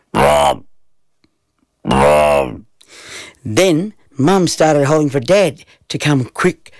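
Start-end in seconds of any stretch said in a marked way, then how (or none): 0.1-2.6 deep droning blob sounds; low falling didgeridoo hum; didgeridoo drone. A narrator's reading. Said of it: "a strange noise came from my gob".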